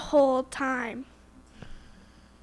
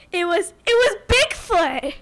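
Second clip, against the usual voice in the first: excited reveal; 3 semitones higher, 6 dB louder, slower than usual. Real, not acted. Reveal of Bigfoot.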